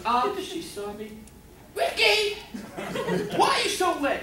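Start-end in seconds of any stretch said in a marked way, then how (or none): 1.8-4.2 high, loud mock-mom yell; scolding mother. Mom catches son sneaking in late, scolding loudly.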